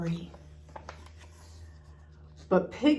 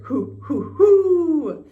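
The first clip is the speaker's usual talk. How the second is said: rising hoots ending much higher and louder; hooting laugh; 9 semitones higher, 10 dB louder, much slower than usual. A narrator's reading. Tigger delighted by the monster, hooting higher and louder. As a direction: hoot with glee, climbing up. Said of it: "hooted Tigger".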